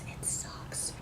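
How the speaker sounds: unvoiced-sounding whispered aside; emphatic whisper. Real, not acted. Vlogger confiding how bad the US version is, whispered.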